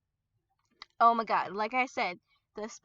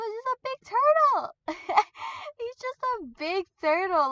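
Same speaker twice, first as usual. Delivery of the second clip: much higher and louder, gushing over something cute; gushing squeal; 11 semitones higher, 5 dB louder than usual. Acted. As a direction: squeal like it's adorable.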